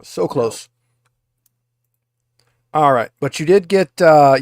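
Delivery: ordinary delivery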